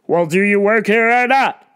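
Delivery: loud, demanding customer call; imitating the pushy shopper demanding service, loud; entitled customer. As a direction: snap for attention like you're owed it. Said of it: "And she's yelling".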